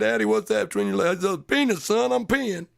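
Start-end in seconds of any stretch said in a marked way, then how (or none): 0.0-1.2 put-on high little kid's voice; squeaky toddler asking dad; child voice. Son's question voiced in story. 1.5-2.7 high, slightly louder matter-of-fact dad; hammed-up dad explaining to his son; dad answer.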